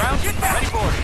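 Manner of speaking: loud barked report over gunfire; combat callout. Acted. Soldier reporting to his captain, loud callout. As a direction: call it out loud to the squad.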